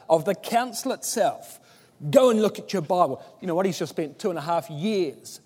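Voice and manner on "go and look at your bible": scornful dismissal. Retold or imitated. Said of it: "spit that out in disgust".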